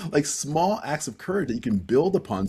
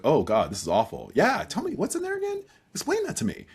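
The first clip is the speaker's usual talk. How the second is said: squirming discomfort; 6 semitones higher than usual. Pretend. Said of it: "be like".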